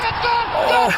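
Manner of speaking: high pained wail of despair; sudden high groan; anguished cry. Real, not acted. Goal ruled out.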